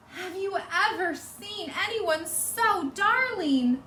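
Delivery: high, slow cooing; doting Missus Watson. Acted. Missus Watson cooing to the wrapped piglet, slow and high. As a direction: gush over the baby piglet.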